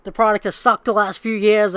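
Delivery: a hammed-up boss admitting fault; mock executive confession. To the fans, mock confession.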